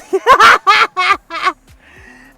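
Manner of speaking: high, loud burst of laughter; really cracking up. Real, not acted. Laughing at the sheriff.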